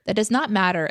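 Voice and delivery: usual speaking voice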